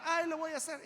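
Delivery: high, sing-song excuse; mock procrastinator's brush-off; putting it off. Preacher mimicking believers who postpone things, high brush-off.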